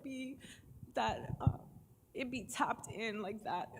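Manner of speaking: ordinary talk, nothing marked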